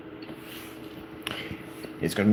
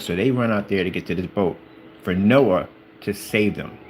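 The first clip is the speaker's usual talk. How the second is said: normal talking voice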